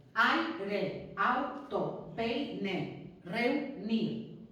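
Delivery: aire, auto, peine, reunir, each in two separate beats; examples split in syllables. Shown as a demonstration. Teacher giving diphthong examples, each word split by syllable. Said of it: "van siempre en la misma sílaba".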